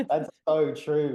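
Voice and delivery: mock-impressed agreement; emphatic agreement. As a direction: stretch it out in delighted agreement. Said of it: "and I was like".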